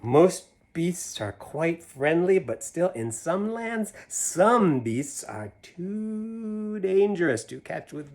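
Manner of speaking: put-on lively read-aloud to kids; animated picture-book reading; story narration. A narrator's reading. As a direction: read the rhyme with a bouncy lilt. Reads Seuss poem to children.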